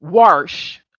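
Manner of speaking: WASH said as "warsh"; intrusive R. Shown as a demonstration. Showing a word she hates hearing, WASH as "warsh". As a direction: put an R in the middle of WASH. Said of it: "where there's a R in wash".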